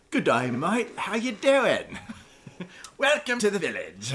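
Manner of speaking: loud, put-on Australian accent, half laughing; hammed-up Aussie greeting; mock Australian accent. To friend, imagining a tribesman talking, in a mock Australian accent. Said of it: "saying, like".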